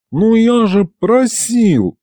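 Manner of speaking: high, drawn-out exasperated protest. A narrator's reading. Demon objects to the Latin name, exasperated. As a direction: complain slowly, as one who already asked.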